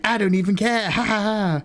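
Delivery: high, smug villain voice with a mock laugh; evil wizard. Streamer voicing the captured evil wizard, high and smug. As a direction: sneer it like a careless cartoon villain. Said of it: "And he's like".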